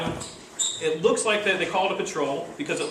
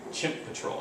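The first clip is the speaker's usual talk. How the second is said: hushed title; 8 dB quieter than usual. Shown as a demonstration. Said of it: "sounds like a name of a cute movie".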